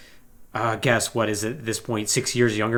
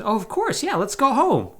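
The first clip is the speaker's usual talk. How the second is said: high spirit voice; 9 semitones higher than usual. Retold or imitated. Spirit's reply acted out.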